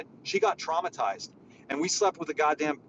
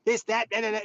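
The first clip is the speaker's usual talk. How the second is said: higher, sneering mimic of a ranting critic; mock a hater's rant, pitched high; mocking accuser voice; 8 semitones higher than usual. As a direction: parody the insults in a raised mock voice. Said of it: "They're just like".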